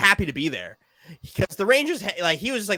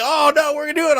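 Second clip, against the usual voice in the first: high mock-confident fans; hammed-up naive optimism; 10 semitones higher, 4 dB louder, much faster than usual. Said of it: "everybody was like".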